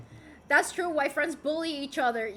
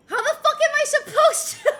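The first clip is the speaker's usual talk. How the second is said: high, loud outburst breaking into laughter; laughing exasperation; 11 semitones higher, 7 dB louder than usual. Real, not acted. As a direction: protest helplessly, loud and laughing. Exasperated by hard username.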